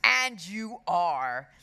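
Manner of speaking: slow, loud, pointed hiss; deliberate hissed jab. A narrator's reading. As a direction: draw it out to taunt him. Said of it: "hissed Henry".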